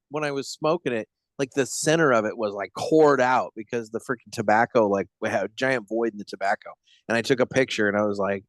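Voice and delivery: ordinary delivery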